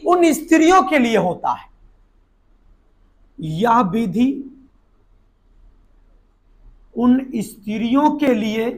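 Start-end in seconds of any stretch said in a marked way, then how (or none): 3.4-8.7 measured note-taking delivery, phrase by phrase; dictation. Shown as a demonstration. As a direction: dictate "या विधि" then "उन स्त्रियों के लिए", long pause between. Repeats it piecemeal for note-taking.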